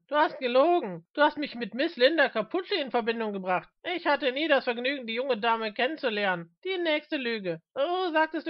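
heated, high-pitched accusation; Mister Smith, indignant accuser. Acted. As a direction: lay out each lie with rising indignation.

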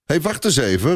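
mild objecting cry; calling out. A narrator's reading. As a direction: call out to stop someone. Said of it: "roept Mathies".

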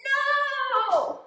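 very high, loud squeaky no; high pigeon no. Acted. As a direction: pout it in a bird squeak. Pigeon answering itself.

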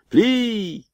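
high, drawn-out call; firing command. A narrator's reading. Officer gives the order to fire, drawn-out command call. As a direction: pitch it way up and hold it.

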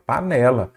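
PANELA said as pa-NE-la, falling at the end; stress on middle syllable. Shown as a demonstration. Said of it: "é a penúltima". (panela com a tônica na penúltima, NE)

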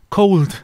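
'cold' ending almost like a 't'; final T sound. Shown as a demonstration. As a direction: finish 'cold' on a t-like stop. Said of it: "como que pronunciaran una T". ('cold' con la d final como una t)

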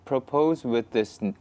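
very slow, deliberate English; slow careful English reading. Acted. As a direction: read each word out slowly. Reads fan question in English slowly.

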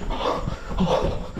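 panting between short phrases; out of breath. Real, not acted. Fleeing a fast pursuer, out of breath. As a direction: gasp it out mid-flight.

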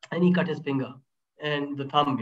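ordinary delivery

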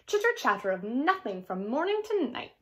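usual speaking voice

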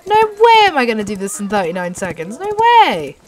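emphatic disbelief. Real, not acted. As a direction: exclaim it as flatly impossible. Giving up on beating the record.

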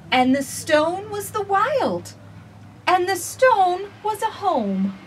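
gentle, measured storybook reading; reading to children. Acted. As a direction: read it slowly to little listeners. Reads picture book aloud.

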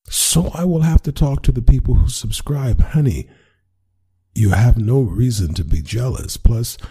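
high, overeager pleading; nervous reassurance. Acted. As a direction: talk her down, too eager.

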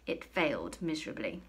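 plain reading voice

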